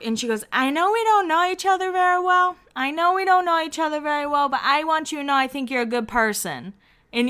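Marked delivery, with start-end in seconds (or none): 0.5-2.5 high, lightly mocked tipsy Lauren; a hint of a drunk girl gushing; drunk pep talk. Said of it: "a little drunk pep talk". 2.8-6.7 higher, lightly mocked tipsy Lauren; a touch of drunk sincerity; drunk pep talk. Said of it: "a little drunk pep talk".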